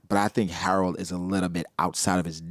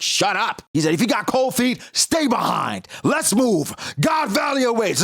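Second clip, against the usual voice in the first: Rox barking orders; 13 semitones higher than usual. Retold or imitated. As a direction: bellow it like a pushy captain. Rox shouting at Struson.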